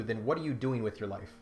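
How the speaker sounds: usual speaking voice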